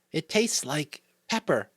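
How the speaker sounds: put-on high-pitched little-boy voice; small sick child. A narrator's reading. Sick young son answers mother.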